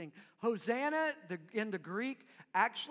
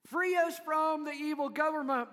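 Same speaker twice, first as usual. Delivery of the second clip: higher and louder demand; demanding crowd; 6 semitones higher, 5 dB louder than usual. Retold or imitated. Crowd demanding rescue on its own terms, raised. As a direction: insist on it like a crowd wanting its way.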